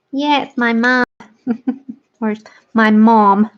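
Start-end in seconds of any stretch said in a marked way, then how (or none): 2.7-3.5 bad American "mom", drawn-out vowel; attempted American accent. Shown as a demonstration. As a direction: put on a clumsy American accent. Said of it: "I can't do American accents".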